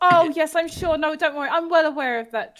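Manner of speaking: ordinary delivery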